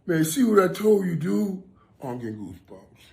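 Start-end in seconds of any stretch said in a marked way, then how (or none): 0.1-1.6 higher and louder, thrilled his voice is back; excited friend. Retold or imitated. Retelling his friend's happy words, high and loud. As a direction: imitate his buddy crowing with delight. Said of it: "he said".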